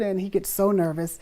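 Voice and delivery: ordinary talk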